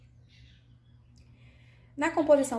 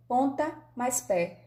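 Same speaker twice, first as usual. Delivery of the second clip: slow separate words; 3 semitones higher, slower than usual. Shown as a demonstration. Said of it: "Observem que eu pronuncio as duas palavras".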